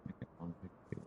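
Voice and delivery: breathy whisper to self; whispered aside. Real, not acted. Gamer whispering a number while building.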